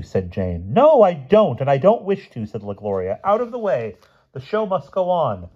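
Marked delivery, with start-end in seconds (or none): 0.7-2.5 high, loud indignant snub; haughty refusal. A narrator's reading. La Gloria to Jane, haughtily refusing. Circus star snubs the children. 3.2-5.5 high, imperious order; haughty command. A narrator's reading. La Gloria clearing the ring, haughtily. Circus star orders children away.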